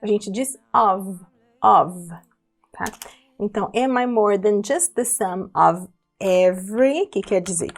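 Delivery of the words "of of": "of" pronounced like ov; "of" com som de V no final; of with a V sound. Shown as a demonstration. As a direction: say "of" ending in a clear V, not F. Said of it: "apesar de ser com a letra F, tem o som de V".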